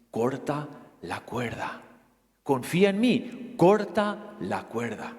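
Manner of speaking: low, soft, slow divine command; hushed God asking for trust. Acted. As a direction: calmly insist from above.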